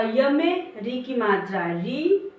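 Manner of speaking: ordinary talk, nothing marked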